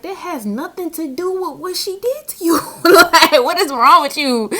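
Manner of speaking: high, loud disbelieving scold; laugh-tinged what is wrong with you; retelling her own shocked reaction, loud and high; incredulous outburst. Retold or imitated. Scolding the hexing client.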